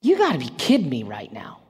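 low, disbelieving inner voice; mock incredulity, pitched well down; incredulous thought. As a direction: think it aloud in a low, dry disbelief. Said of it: "I had this thought in my mind".

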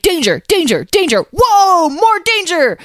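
hammed-up cries climbing very high; mock alarm. Lyle mimics the whole quest's dangers, mock alarm.

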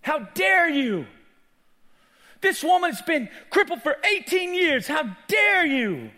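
high, loud outrage with drawn-out stresses; retold anger. Preacher scolding the ruler over the crippled woman, angry shout. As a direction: shout it slowly in indignation. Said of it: "I was literally getting angry".

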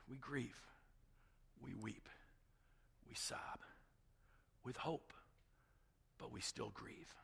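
soft, solemn, halting delivery; hushed preaching. Real, not acted. Preacher on grieving a lost friend, hushed and solemn. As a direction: drop to a grave hush between pauses.

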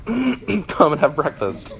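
a slow, fond repeat of the line; savoring. Shown as a demonstration. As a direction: savor the line like a favorite invitation. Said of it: "my favorite line of Jesus".

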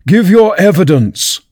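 high, slow angry command. A narrator's reading. The king repeats his order to the Hatter, angrily. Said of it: "the king repeated angrily".

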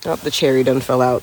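normal talking voice

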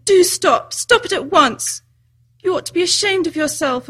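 loud, high exasperated order; bawling scolding. A narrator's reading. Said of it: "bawled Lucy in his ear".

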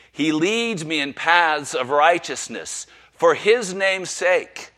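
slow, loud, lifted proclamation; solemn recital. Acted. Preacher reciting the psalm with the church, slow and full. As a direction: ring it out to the room. Congregation joins reading.

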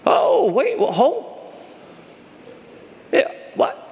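high startled interjections; playful feigned confusion; mock surprise. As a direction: pretend not to hear the crowd. Mock surprise at congregation answers.

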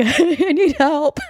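high, half-laughing mock sob; mock tearful plea. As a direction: imitate a weepy cry for help, laughing at yourself. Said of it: "ugly crying".

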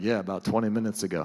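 low, quick monotone aside; mock a sullen reply under the breath; muttering churchgoer. Churchgoer answering the pastor, muttered low and quick.

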